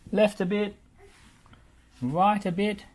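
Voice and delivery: high, loud directing calls; yelling. A narrator's reading. Bob yelling directions to reversing Muck, high and loud. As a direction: guide the reversing truck by yelling. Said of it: "yelled Bob".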